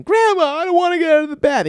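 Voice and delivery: high, loud whine held on one note; mock toddler wail; wailing child. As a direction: imitate a kid running off wailing to grandma. Child fleeing to grandma, retold.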